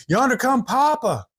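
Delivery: high-pitched excited announcement; calling out. A narrator's reading. Little brother calling out that Papa is coming, high call. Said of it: "called out and said".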